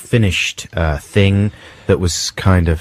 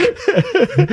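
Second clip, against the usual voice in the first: high laugh. Real, not acted. Laugh after joke.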